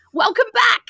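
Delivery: ordinary delivery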